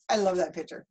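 ordinary delivery

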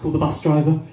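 normal talking voice

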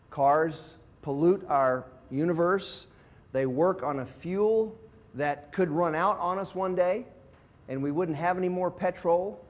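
ordinary delivery

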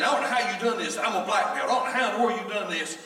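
can't believe he got beaten; stunned disbelief. Retold or imitated.

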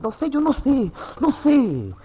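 high-pitched, repeated plea of ignorance; exasperated protest. Real, not acted. Streamer baffled by the PC's specs, high exasperated protest. Exasperated complaint about the computer.

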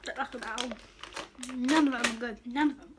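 grimacing at bad taste; chewing while talking. Real, not acted. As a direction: mouth partly full of candy. Seen 3: candy put in mouth at -7; cheeks bulge, chewing while grimacing.

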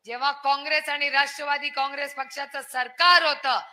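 usual speaking voice

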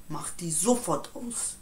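usual speaking voice

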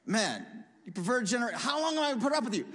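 fast, high, fed-up scolding; mock exasperation, pitched high and quick; exasperated rebuke. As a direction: snap it out like a worn-out parent. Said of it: "here he looks and says".

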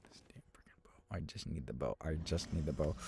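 low, hushed muttering; whispering to self. Real, not acted. Gamer needing the bow in a fight, whispered muttering. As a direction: whisper it under your breath.

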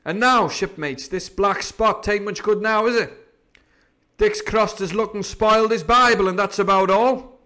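loud, high pirate address; rally the crew, dismissing the threat; Silver, triumphant pirate leader. Acted.

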